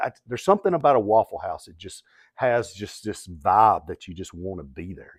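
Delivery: ordinary delivery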